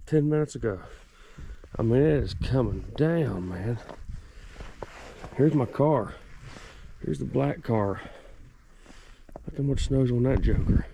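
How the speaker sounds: normal talking voice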